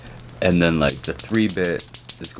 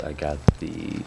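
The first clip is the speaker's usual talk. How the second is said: whisper; 7 dB quieter than usual. Real, not acted. Whispers mid-explanation.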